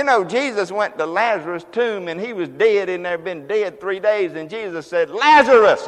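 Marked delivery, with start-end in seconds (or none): 5.1-5.9 high, ringing loud call of the name. Acted. Jesus calling Lazarus out of the tomb loudly. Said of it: "the Bible says in a loud voice".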